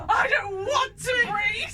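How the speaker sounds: high, loud, slow tantrum shout; screaming child. Retold or imitated. Friend imitates her daughter's breathing tantrum, shouting. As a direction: scream it like a defiant toddler. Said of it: "what Darcy does is".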